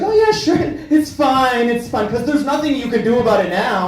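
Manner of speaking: high, falsely agreeable Midwesterner. Acted. Customer to barber about a bad haircut, high fake approval. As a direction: pitch it up and pretend it's fine. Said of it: "my Midwestern voice is the voice".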